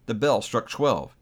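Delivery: plain reading voice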